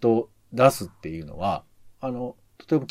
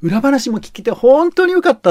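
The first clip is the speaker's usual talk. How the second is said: higher and louder, a bright happy announcer's gush; imitating a cheerful announcer; 11 semitones higher, 8 dB louder than usual. Podcaster imitating a radio announcer recommending a segment, cheerfully excited. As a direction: play back the host's delight at a segment she loved. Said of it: "楽しそうに紹介するんですよ".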